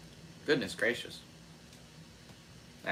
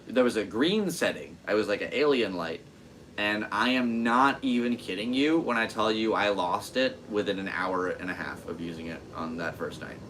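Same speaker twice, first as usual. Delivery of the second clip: normal talking voice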